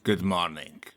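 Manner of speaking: put-on low, soft French accent. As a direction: greet softly as a put-on Frenchman. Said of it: "you recognize that mine was French".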